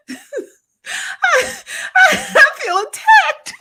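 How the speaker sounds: mock offended, cracking up; laughing. Real, not acted. Vlogger tells viewer she feels attacked, laughing.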